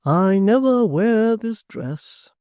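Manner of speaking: put-on slow, fond old father; musing hearty tradesman; locksmith voice. A narrator's reading.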